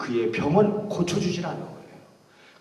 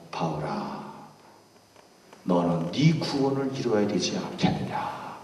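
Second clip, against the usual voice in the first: much lower, softer, solemn answer; voice of God; 7 semitones lower, 8 dB quieter than usual. Acted. God gently answering Paul in prison, low and hushed.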